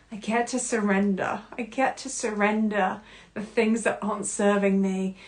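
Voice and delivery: usual speaking voice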